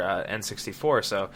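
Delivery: usual speaking voice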